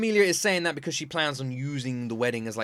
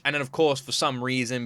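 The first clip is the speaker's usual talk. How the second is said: normal talking voice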